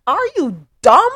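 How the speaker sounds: high, loud, incredulous telling-off; indignant scolding. Real, not acted. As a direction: scold in emphatic bursts with pauses between. Host scolds officer for parking there.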